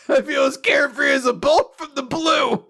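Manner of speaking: high, loud forced cheerfulness; overeager shout. Retold or imitated. A girl insisting she's fine, loud chipper shout. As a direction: belt it out, overly chipper. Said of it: "She's like".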